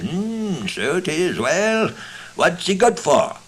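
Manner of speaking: a sceptical stranger eyeing the frog. Acted. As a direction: lift the voice up for the doubtful stranger. Stranger voiced, high pitched.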